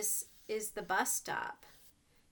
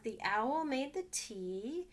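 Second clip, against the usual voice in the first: plain reading voice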